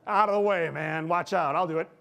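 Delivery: loud, high, barging shout; pushy shout. Acted. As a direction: shove everyone aside with your voice. Acting a pushy self-reliant guy.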